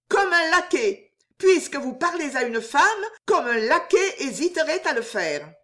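high, scornful comeback starting loud; indignant retort. A narrator's reading. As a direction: throw the insult back at him. Outraged girl orders suitor out.

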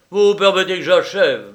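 loud, high, brisk request; big-voiced casual courtesy; hearty host. A narrator's reading. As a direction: toss it out loudly while busy. Lyra busy, calls to visitor.

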